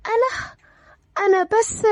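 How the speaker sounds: high, faltering, tearful start; near tears. Acted. Aya tries to explain to jealous man, near tears. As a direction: begin softly and falter, tears gathering. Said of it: "آية والدموع تجمعت في عيونها".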